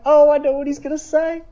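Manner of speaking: very high excited fan voice; giddy fan. Retold or imitated. Reliving the Hunter moment to cohost, very high giddy gush. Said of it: "And I was like".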